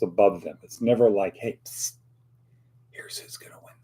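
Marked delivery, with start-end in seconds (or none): 1.4-3.7 very soft conspiratorial whisper; whispered tip. Retold or imitated. Imagined Holy Spirit slipping someone the winner, whispered.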